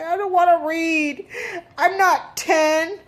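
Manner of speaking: mock the son's bratty complaint; whiny teen son. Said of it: "He was like".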